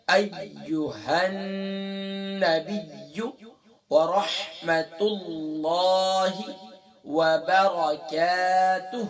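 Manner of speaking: slow, drawn-out chanted recitation. Shown as a demonstration. Teacher reciting the tashahhud for students, slow and chanted. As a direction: intone each phrase slowly like a prayer.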